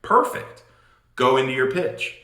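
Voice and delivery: ordinary talk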